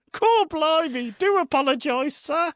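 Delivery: overdone cheery British put-on; mock British accent. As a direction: play an American faking British to blend in. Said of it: "Trying your best to fit in".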